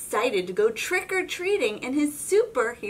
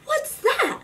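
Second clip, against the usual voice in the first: high, loud alarmed question; a child spooked by a noise; high sudden question; startled Callie; 6 dB louder than usual. Acted. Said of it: "asks Callie".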